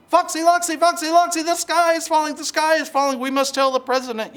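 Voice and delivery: very high, loud, frantic chicken voice; panicked clucking hen. Acted.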